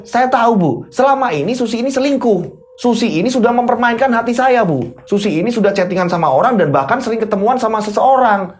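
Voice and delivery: high, insistent complaint that keeps climbing; heated accusation. Acted. Husband accusing wife before her parents, high and heated. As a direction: press the charge with aggrieved certainty.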